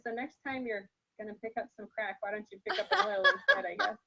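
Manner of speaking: laughing through a joke. Real, not acted. Joking about crack, laughing.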